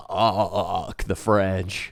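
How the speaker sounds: very slow, stretched-out low-volume delivery; drawn-out mock declaration.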